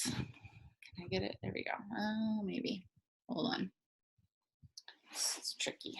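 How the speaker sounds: whispered aside to herself. Real, not acted. Reader whispering to herself while turning the page. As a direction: mutter it under her breath.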